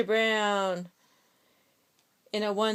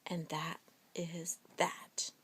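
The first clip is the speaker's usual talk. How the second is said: very soft breathy whisper; 7 semitones lower, 15 dB quieter than usual. Shown as a demonstration. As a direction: whisper it softly. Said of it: "Last a whisper".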